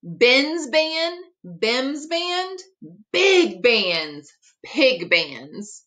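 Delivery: plain reading voice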